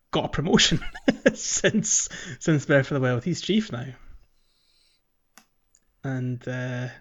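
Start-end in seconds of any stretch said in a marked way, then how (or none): none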